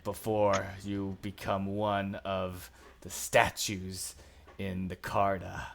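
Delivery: loud snarled warning that fades. Acted. Gnoll captain threatening the party, loud snarl fading off. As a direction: snarl them off the street. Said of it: "snarls at all of you".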